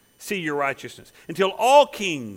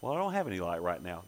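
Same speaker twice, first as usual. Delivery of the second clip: lightly voiced light bulb; 10 semitones lower, 8 dB quieter than usual. A burnt-out light bulb excusing itself, lower and softer. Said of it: "It can say".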